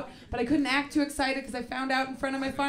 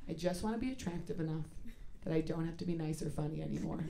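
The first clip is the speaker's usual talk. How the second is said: much softer, fast and low; quiet confession; 6 semitones lower, 12 dB quieter, faster than usual. Real, not acted. Comic confiding her true goal to the audience, soft and quick. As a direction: rush it out softly like a guilty secret.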